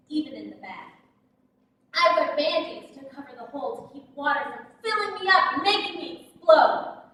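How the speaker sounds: ordinary talk, nothing marked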